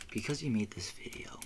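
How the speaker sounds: hushed whispered aside; whispering. Real, not acted. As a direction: drop to a breathy secret whisper. Whispered aside after quote.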